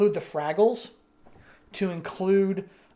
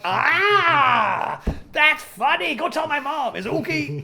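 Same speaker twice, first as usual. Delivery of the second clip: high, louder silly muppet voice; goofy puppet voice; 10 semitones higher, 7 dB louder, slower than usual. Retold or imitated. Speaker imitates a muppet reacting to Rolf, high goofy voice. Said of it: "I'm like".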